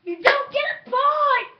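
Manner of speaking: high, loud gloat; gloating shout. Real, not acted. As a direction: gloat at the loser. Gloating at loser.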